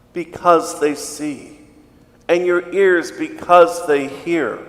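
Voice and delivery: solemn liturgical proclamation; Gospel reading. Acted.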